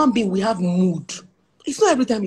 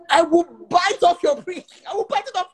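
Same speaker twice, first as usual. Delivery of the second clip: mock furious threat; 10 semitones higher than usual. Wife threatening her husband, mock furious and high. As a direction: shriek it at him in jest.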